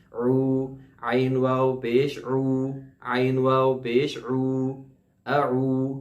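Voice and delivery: عو with full rounding and ain from the throat; slow, round lips on عو. Shown as a demonstration. Teacher drilling the ain sound for students. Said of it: "عین کی آواز نکالنی ہے".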